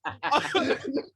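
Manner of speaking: high, laughing interruption; helpless laughing disbelief; cracking up. Real, not acted. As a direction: burst out laughing through the words. Cracks up at the volleyball story.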